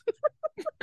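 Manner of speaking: short laugh way up high; high squeal of laughter. Real, not acted. Mocking the nice offer.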